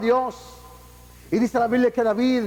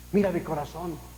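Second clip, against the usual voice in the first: hushed, low prayer to the Lord; a sinner's soft whisper-like plea to God; 6 semitones lower, 6 dB quieter than usual. Acted. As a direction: quietly pour out your heart.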